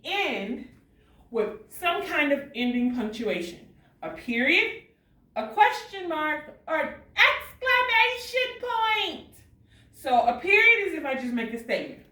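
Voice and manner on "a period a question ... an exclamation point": rising excitement. Shown as a demonstration. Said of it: "if I say it with excitement".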